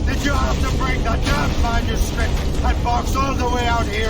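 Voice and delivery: loud, high furious yell; angry shout. Acted. Shay yelling at Liam about the manuscript, loud and angry. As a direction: shout him down across the cavern.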